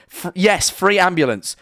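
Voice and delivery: usual speaking voice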